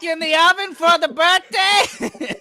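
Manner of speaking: mock southern hillbilly. Mock hick voice calling Ma again.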